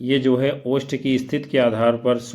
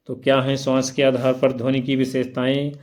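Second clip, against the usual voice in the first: ordinary delivery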